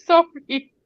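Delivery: high, drawn-out apology through laughter; helpless laughter. Real, not acted. Host apologises for the nickname slip, helplessly laughing. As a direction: try to apologise while cracking up. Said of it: "wetting myself laughing".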